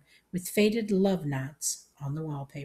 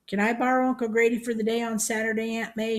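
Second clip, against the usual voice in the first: high, raised voice; pitched-up calling question; howled; 6 semitones higher, 4 dB louder than usual. A narrator's reading. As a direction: holler a request to a deaf aunt. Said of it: "she howled".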